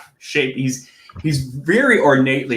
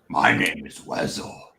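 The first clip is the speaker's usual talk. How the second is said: low breathy whisper, voice dropping; whispered goblin intro; 12 semitones lower, 6 dB quieter than usual. Acted. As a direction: drop to a hushed whisper. Jester introduces himself, voice drops.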